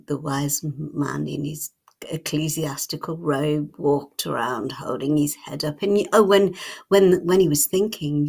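ordinary delivery, nothing marked

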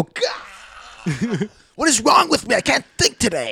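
high, strained and frantic, breaking into a laugh; mock frustrated roommate. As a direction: ham up a panicked student losing it. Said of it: "And then you just go".

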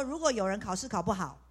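usual speaking voice

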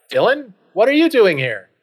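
high, loud astonished question; startled surprise. Acted. Hero finding a friend in the werewolf cabin, acted astonishment. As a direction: blurt it out in disbelief. Hero finds Dylan behind the door.

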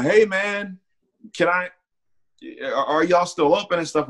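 mock the pushy old customer, voice lifted high; imitating the old man. Old man asks if bank open.

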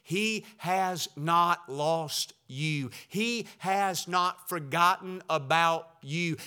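usual speaking voice

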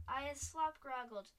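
slow, loud squawking cry; a bound giant squawking in fury; giant's squawk. A narrator's reading. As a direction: squawk it out like an angry bird. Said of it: "squawked the Gizzard Gulper".

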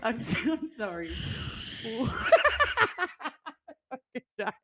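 apology broken up by laughter; can't stop laughing while trying to speak; real laughter, words squeezed out; laughing helplessly. Real, not acted. Cracking up at the story.

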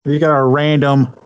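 usual speaking voice

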